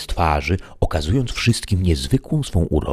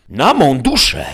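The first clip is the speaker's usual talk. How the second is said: acted outcry, pitched up; exclaiming; 10 semitones higher, 5 dB louder than usual. A narrator's reading. Don Quixote exclaims at Sancho's refusal, pitched up. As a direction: cry it out as an outraged knight. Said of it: "zawołał Don Kichot".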